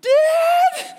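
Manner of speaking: very high, shouted mock squeal; hammed-up panicked squeak; terrified child. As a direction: shriek it like a scared little brother. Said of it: "he's terrified".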